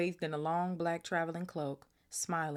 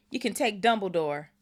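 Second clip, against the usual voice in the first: louder yell, pitch up a little; 5 semitones higher, 9 dB louder than usual. A narrator's reading. Hagrid yelling at Malfoy, a first, milder yell. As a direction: shout it, not yet at full force. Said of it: "yelled Hagrid".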